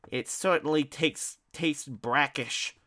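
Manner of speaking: slow, high, sniffy complaint; pompous disdain. A narrator's reading. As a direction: drag it out in disgust. Ignatius complaining.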